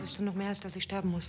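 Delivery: timid, hardly audible line reading; too quiet. Acted. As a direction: say it almost under the breath. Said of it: "ruhig ein bisschen lauter sprechen".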